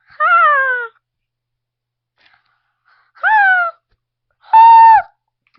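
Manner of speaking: high, loud bursts of "Ha"; power-move yells. Retold or imitated. Child acting out Eleven using her powers, forceful high yells. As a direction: shout each burst as if firing a power. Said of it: "that's her using her powers".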